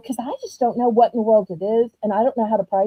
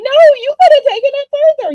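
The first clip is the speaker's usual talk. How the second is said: high, fast, loud eager insistence; urging her on, much higher and faster; pitched way up, rushed and loud; excited insistence; 13 semitones higher, 5 dB louder, faster than usual. Retold or imitated. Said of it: "And I'm like".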